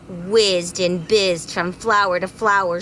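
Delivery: plain reading voice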